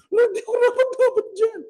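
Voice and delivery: high, whiny pretend protest to the Lord; mock timid excuse. Believer excusing himself to the Lord, high and whiny.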